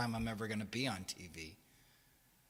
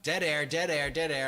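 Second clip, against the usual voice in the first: louder, raised impatient chant; impatient inner voice; 4 semitones higher, 7 dB louder than usual. Retold or imitated. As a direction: call it out in mock alarm, repeating. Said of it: "in my head, I'm like".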